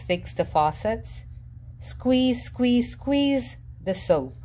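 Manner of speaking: ordinary delivery, nothing marked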